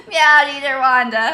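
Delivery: loud, slow sing-song name; silly greeting. Real, not acted. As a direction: stretch the name playfully.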